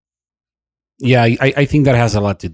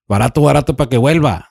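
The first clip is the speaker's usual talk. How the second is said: fast, higher, louder street hawker; vendor call; 6 semitones higher, 5 dB louder, faster than usual. Retold or imitated. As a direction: shout it like a market seller.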